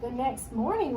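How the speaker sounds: plain reading voice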